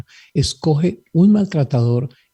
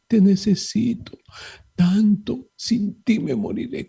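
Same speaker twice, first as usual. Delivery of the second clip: high, much softer, swooning mock lament; clingy lover plea; 5 semitones higher, 4 dB quieter than usual. Priest parodying emotional dependence, hammed-up lovesick plea. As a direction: sigh it like a lovesick soap heroine.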